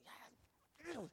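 high-pitched mock griping; fussing. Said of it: "I see him over there fussing".

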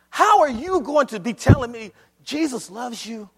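emphatic preaching. Real, not acted.

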